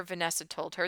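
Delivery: ordinary delivery, nothing marked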